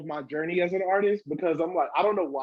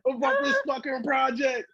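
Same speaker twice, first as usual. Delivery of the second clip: retold heated rant; 8 semitones higher than usual. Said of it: "I was heated".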